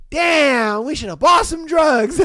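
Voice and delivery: loud, high comic outburst; mock regret. Shop worker after finding the bathroom mess, loud mock regret. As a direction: shout it as an exasperated punchline.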